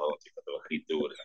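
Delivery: ordinary talk, nothing marked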